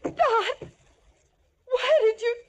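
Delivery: high-pitched, halting shock; horrified. Acted. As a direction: gasp out the question, aghast. Aghast after captor's violent act.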